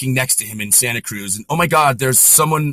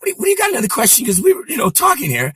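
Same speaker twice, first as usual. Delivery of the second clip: high put-on voice, brushing it off; higher-pitched impersonated banter; Rickles; 9 semitones higher than usual. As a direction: imitate an amused comic reassuring a kid. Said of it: "He goes".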